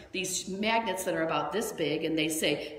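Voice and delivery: normal talking voice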